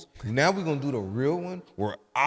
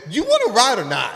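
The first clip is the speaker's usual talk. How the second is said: blunt demand; 9 semitones higher, 9 dB louder than usual. Retold or imitated. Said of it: "just ask the damn".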